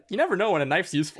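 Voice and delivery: excited character quote. Retold or imitated. GM quotes character, loud fast.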